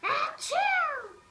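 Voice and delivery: high, loud blasting achoo; loud sneeze. Acted. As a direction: sneeze right at your friend. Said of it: "try to sneeze more softly".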